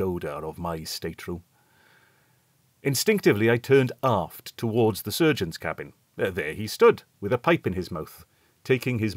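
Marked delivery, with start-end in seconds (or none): none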